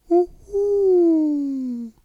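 high, loud, long-held owl call; owl hoot. A narrator's reading. Owl calls in the night, long high hoot. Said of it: "den Ruf einer Eule".

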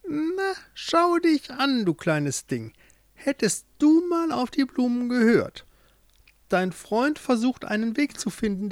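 put-on cackle-toned old witch sneering at her captive. A narrator's reading. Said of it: "Bei Jorindes Käfig hielt sie kurz inne und sprach". (hohe, hämische Hexenstimme)

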